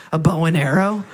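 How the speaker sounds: low, deadpan robotic Zuckerberg; flat, sheepish lie in a low voice; Zuckerberg impression. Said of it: "And he goes".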